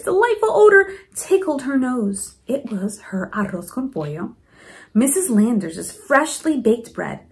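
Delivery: plain reading voice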